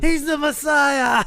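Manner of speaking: high, slow proclaiming shout. Retold or imitated. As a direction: shout it out like a zealous crowd. Crowd proclaiming, Monty Python scene.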